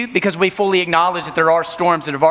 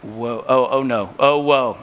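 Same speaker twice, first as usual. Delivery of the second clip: uneasy groan; 5 semitones lower, slower than usual. Retold or imitated. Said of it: "He leaned back and he went".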